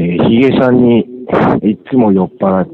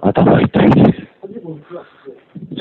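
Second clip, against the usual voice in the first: hungover moan; 7 semitones higher, much slower than usual. Real, not acted. Hungover caller moans.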